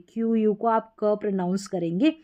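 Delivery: ordinary delivery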